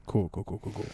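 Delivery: low, soft mock string of agreement; retold awkward acknowledgment. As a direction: imitate someone muttering along, low and quiet. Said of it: "Andrew was looking at me the entire time like".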